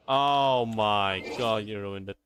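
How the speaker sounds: ordinary delivery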